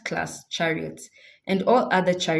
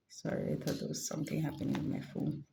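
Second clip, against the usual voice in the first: quiet aside; 3 semitones lower, 13 dB quieter, faster than usual. Real, not acted. Aside about phone.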